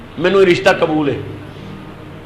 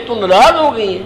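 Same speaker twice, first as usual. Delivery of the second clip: tearful; 8 semitones higher than usual. A narrator's reading. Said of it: "آپ رو کے فرمان لگے".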